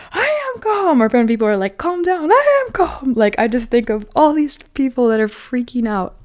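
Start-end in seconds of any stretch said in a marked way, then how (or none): 0.1-1.0 high, loud "I am calm" shouted in a panic; hammed-up panicked denial; mock frantic yell. Movie people freaking out. 1.8-2.3 high, loud "Calm down" yelled; hammed-up frantic command; mock shout. Movie people freaking out. 2.3-3.1 very high, loud "I am calm" in a shriek; hammed-up panicked shout; mock frantic yell. Movie people freaking out.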